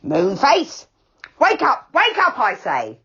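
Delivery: loud call to wake him; angry neighbour shouting through the door. A narrator's reading. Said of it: "came shouting at Moonface's door".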